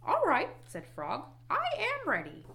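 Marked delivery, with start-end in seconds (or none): 0.0-0.5 high, eager frog; high, louder storybook Frog; Frog character voice. A narrator's reading. Frog agreeing in read-aloud story. 1.5-2.4 very high, eager frog; high-pitched storybook Frog; Frog character voice. A narrator's reading. Frog agreeing in read-aloud story.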